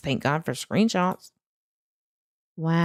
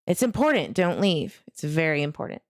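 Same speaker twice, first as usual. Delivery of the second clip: usual speaking voice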